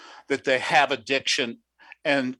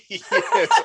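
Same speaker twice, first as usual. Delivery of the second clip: laughing yes; 13 semitones higher, 6 dB louder than usual. Real, not acted. Laughs at band name joke.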